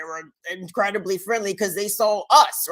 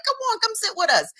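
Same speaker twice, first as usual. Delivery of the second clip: warm welcome; 11 semitones higher, faster than usual. Retold or imitated. Welcoming fellow brown people.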